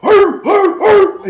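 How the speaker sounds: high, loud barking noises like a German shepherd; dog bark imitation. Telling how the guard dogs barked, mimicking the bark. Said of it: "the bark".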